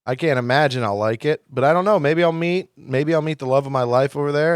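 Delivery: usual speaking voice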